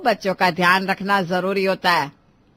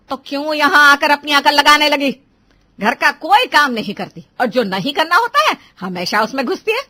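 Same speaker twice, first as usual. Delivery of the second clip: raised-voice tirade; angry scolding; 4 semitones higher, 6 dB louder than usual. Acted. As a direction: berate the daughter-in-law, pitch climbing at the end. Elder keeps scolding the bride. (ग़ुस्से में ताने भरी डाँट)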